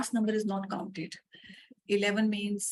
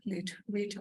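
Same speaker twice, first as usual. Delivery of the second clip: normal talking voice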